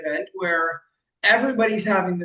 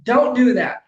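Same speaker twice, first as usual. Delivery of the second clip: louder firm order; firm command; 5 semitones higher, 7 dB louder, slower than usual. Retold or imitated. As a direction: stop them firmly. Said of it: "He's like".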